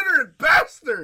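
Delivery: loud, high, playful outrage; mock outraged shout. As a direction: yell it in fake indignation. Mock outrage at friend's nap.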